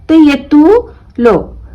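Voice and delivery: तू लो drawn out slowly, in two separate parts; slow split syllables. Shown as a demonstration. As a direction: break तू लो apart slowly. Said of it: "ऐसा भी पढ़ सकते हैं". (धीरे धीरे तू लो)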